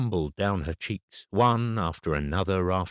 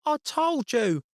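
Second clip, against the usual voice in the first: put-on very high, whiny child's voice; a boy whining to get his way; child whinging through the eardrums; slower than usual. A narrator's reading. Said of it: "with a whinging voice".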